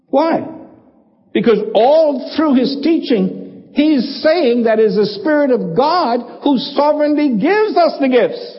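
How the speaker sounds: rising preaching. Acted. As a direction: climb toward the climax of the point. Building sermon climax.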